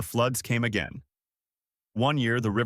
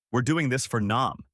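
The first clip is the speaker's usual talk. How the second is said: ordinary delivery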